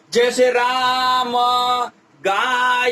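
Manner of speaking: राम and गाय with vowels held very long; स्वर खींचकर बोलना; stretched vowels. Shown as a demonstration. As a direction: drag the vowel out slowly as if calling someone. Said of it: "आ, ऐसे करके खींचा जाता है".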